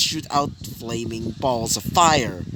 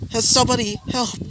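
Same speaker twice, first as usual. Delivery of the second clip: high, panicked cry for help; a woman shrieking after her purse is stolen; screaming for help; 10 semitones higher than usual. Acted. Robbed woman screams for help, high and panicked. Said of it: "he heard a scream".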